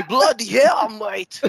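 hammed-up British slang bark; mock British. Friends joking about accents, a hammed-up British exclamation. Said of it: "Makita mo si Miggy".

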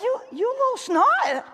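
high, slow, laughing "little snot"; amused mock scolding. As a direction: scold the grandkid fondly through a laugh. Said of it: "I'm like".

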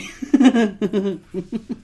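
high, rolling laugh; real laughter. Real, not acted. Joking he has no friends, laughing. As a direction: crack up at your own joke. Laughs after correcting himself.

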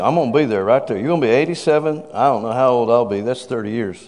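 ordinary delivery

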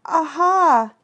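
high, loud, nervous Aha!; anxious aha. Acted. Piglet anxious in Kanga's house, nervous squeal. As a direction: yelp it out looking for help. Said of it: "looking around anxiously".

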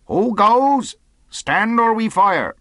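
loud, high challenge; hailing shout. A narrator's reading. Captain challenges the buccaneer from the porch, hailing shout. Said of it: "Then he hailed the buccaneer".

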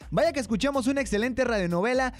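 upbeat radio announcer voice; bright call to listeners to phone in; cheerful host read; radio host announcing. Acted. Host returns from radio drama.

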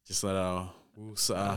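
very soft, slow release of anger; calming release. Shown as a demonstration. Showing a friend how to let anger out, hushed and calming. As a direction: let it out softly and slowly. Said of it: "let your fucking anger out".

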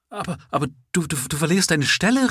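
high, loud, stammering plea; mother cries out to her son, panicked; frantic cry. A narrator's reading. Mother begging son to get up, crying out loud. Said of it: "rief sie".

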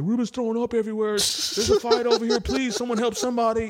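high, fast frantic reporting; mock panic. Partygoers in a chaotic house, mock panicked calling. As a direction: rattle it off in a panic.

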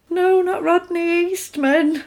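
high, drawn-out playful lament; mock dismay. Fan hears her childhood crush is in a coma, mock dismay. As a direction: wail lightly over a beloved crush.

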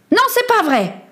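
put-on high-pitched boyish outcry; impulsive cry. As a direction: blurt it out from the heart. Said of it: "n'avait pas réfléchi et avait crié".

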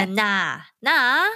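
Australian-accented "nah"; Aussie accent. Shown as a demonstration. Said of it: "in an Aussie way".

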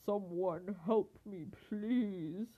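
storybook lion's drawn-out plea; sick lion calling for help; roaring lion. A narrator's reading. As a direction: plead as a poorly lion. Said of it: "the lion roared".

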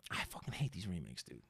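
breathy whispered grumble. Real, not acted. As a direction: whisper it like a confession. Quiet aside about hated remakes.

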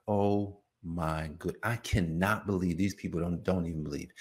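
low, soft, weary disbelief; exasperated disbelief. Acted. Jesus to himself about unbelieving friends, quietly exasperated. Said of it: "Jesus is like".

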